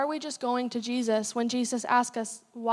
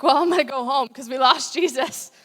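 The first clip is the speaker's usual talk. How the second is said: louder, laughing through the line; mock-resigned disciples; 9 dB louder than usual. Disciples give up on finding Jesus: loud laughing mock resignation. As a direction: play the clueless disciples giving up.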